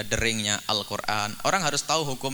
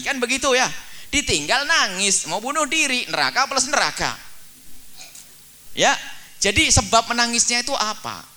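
high, loud sermon climax; fiery preaching; 11 semitones higher, 5 dB louder than usual. Acted. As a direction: preach it hard to the congregation. Preaching at full force.

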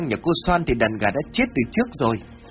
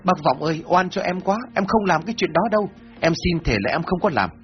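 high, pleading voice, begging for mercy; frightened pleading, a touch of tears; 8 semitones higher than usual. A narrator's reading. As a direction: beg the elder to believe you, pitched up and desperate. Accused man pleads innocence.

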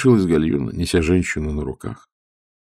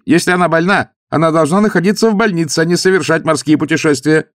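loud, higher preachy lecturing; 10 semitones higher, 6 dB louder than usual. A narrator's reading. Young officer lecturing the old man about his sick daughter. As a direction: talk down to him. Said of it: "наставительно произнёс".

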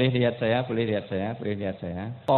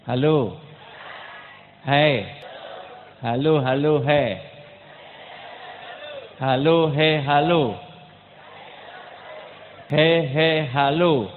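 punchy, raised-voice hailing; shouted greeting drill; loud call-and-response; 2 semitones higher, 4 dB louder than usual. Shown as a demonstration.

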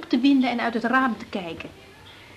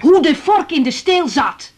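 raised voice; 6 semitones higher, 7 dB louder, slower than usual. Acted. Interrupted, pushes on over him.

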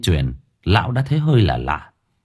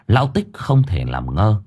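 ordinary delivery

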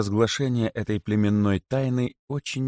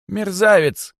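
high, loud outburst from the rabbit; sudden high-pitched insult, thrown out loud; defiant rabbit; 12 semitones higher, 7 dB louder, slower than usual. A narrator's reading. Rabbit calls out from Kosoy's belly, defiant and high. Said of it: "вдруг снова произнёс кролик из живота".

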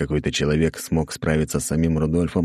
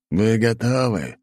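demon king voice; 6 semitones higher, slower than usual. Acted. King rallying.

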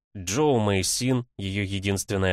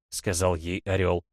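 usual speaking voice

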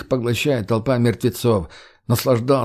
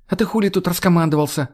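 snapping back; 7 semitones higher, faster than usual. Acted. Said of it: "огрызнулась Марго".

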